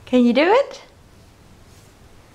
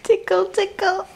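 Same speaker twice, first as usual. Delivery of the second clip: giggly tickle attack; 4 semitones higher than usual. Real, not acted. Tickling baby, laughing.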